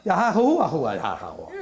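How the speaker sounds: slow, laughing parody of "Yahahuahuayahawa"; mock over-long pronunciation, laughing; mock mangled name. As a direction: drag out "Yahahuahuayahawa" slowly with piled-up extra syllables, laughing. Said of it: "all the extra syllables".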